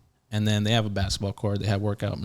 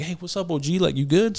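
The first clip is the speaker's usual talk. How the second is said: higher, casual homie greeting; friendly inmate greeting; 8 semitones higher than usual. Retold or imitated. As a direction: lift the voice into a loose "what's up". Chicano inmates greeting him.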